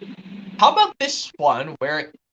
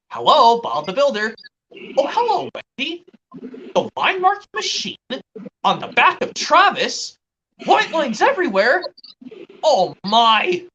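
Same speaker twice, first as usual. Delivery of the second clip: high-pitched children's cartoon exclamations; Bob the Builder impressions; 7 semitones higher than usual. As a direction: act out kids' cartoon characters, pitched way up. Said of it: "from season one of Bob the Builder".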